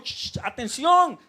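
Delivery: shush, then call out sharply to a distracted crowd; loud, high summons; calling for attention. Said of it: "A veces le decimos".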